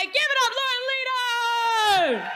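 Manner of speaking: big announcer call, stretching the name; announcing loudly. Real, not acted. Calling the next speaker on stage.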